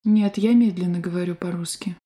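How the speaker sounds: ordinary talk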